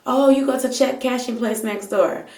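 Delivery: put-on high, accented cashier brushing her off; mock salon worker pointing next door. Cashier sends the customer to check cashing, high and offhand.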